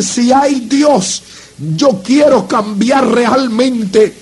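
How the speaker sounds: slow, stretched plea, no rush; slow pleading prayer. Retold or imitated. Kneeling before the preacher, he begs God in a slow, drawn-out voice. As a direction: drag out each word as a heartfelt plea. Telling God he wants to change.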